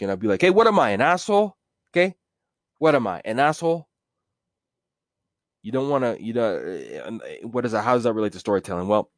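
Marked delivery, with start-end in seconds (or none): 0.4-3.9 mock outrage, raised and punchy; indignant mobster. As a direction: bark back like an insulted gangster. Offended mobster retort in story.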